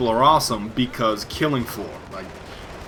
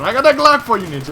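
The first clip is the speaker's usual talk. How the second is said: quoting a player; 7 semitones higher, 6 dB louder, faster than usual. Retold or imitated.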